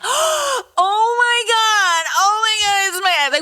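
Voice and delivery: high, stretched-out wailing disbelief; mock shock. Imitating contestants on the beach, hammed-up shock. Acts overblown reaction to ex.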